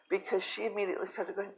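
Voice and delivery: ordinary delivery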